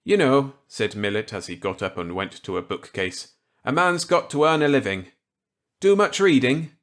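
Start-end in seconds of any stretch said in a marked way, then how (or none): 0.0-0.5 higher, louder voice for kindly Millet; Millet's voice. Acted. Millet offering Walker a gambit, kindly. 3.6-6.8 higher, louder voice for kindly Millet; Millet's voice. Acted. Millet offering Walker a gambit, kindly.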